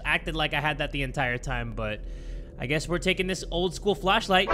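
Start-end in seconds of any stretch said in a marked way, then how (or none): none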